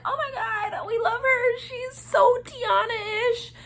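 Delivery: very high, squealed imitation of delight; gushing judges. Judges love the auditioner, imitated high gushing squeal. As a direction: gush in a high voice, drawing out the end.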